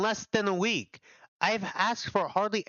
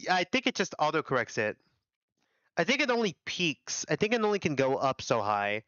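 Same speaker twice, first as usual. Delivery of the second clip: ordinary talk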